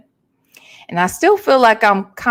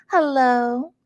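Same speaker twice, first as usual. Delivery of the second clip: usual speaking voice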